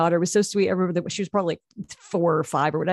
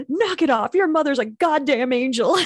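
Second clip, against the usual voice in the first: high, sharp mock scolding of a kid; scolding stranger; 8 semitones higher, 3 dB louder than usual. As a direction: tell the brat off on the mother's behalf. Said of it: "she walked up to the kid and she's like".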